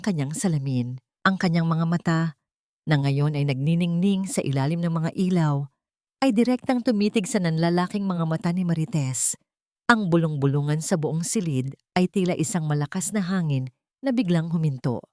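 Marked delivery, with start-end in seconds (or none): none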